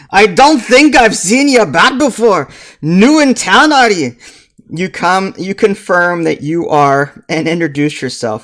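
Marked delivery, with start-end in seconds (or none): 0.1-4.2 loud, high dwarf brogue; boisterous dwarf baker greeting a newcomer; Grisbeck Hearthstone. Acted. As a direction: belt out a hammed-up dwarf accent. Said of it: "he continues to chat".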